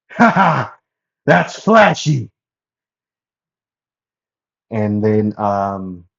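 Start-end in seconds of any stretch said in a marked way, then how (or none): 0.1-2.3 loud, high, showy exclamation with a laugh; Tengen. Acted. Tengen delighted at five Tanjiros running in, flamboyant shouting. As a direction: boast it big and high, stretching the words. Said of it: "Tengen would say".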